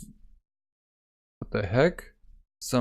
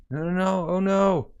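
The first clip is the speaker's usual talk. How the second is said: rising high, frantic no no no; panicked; 6 semitones higher than usual. Acted. Captured girl realizing her fate, frantic high panic. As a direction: fear building as she realizes.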